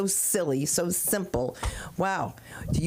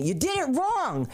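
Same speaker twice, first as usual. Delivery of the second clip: retold scolding husband; 7 semitones higher than usual. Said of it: "so he says to me".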